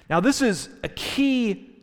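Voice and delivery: ordinary talk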